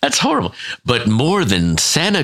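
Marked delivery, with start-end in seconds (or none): 0.0-0.5 a high, shocked cry; horrified squeal. Real, not acted. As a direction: recoil at something gruesome. Reacting to the grim story.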